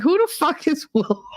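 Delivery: high, baffled retort breaking into laughter. Retold or imitated. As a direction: crack up on the name. Baffled by autocorrected name, laughing.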